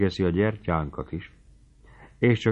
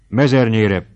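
determined; 3 semitones higher, 7 dB louder, slower than usual. A narrator's reading. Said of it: "mondta a kocsisnak eltökélten".

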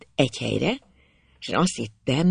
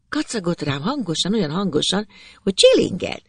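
usual speaking voice

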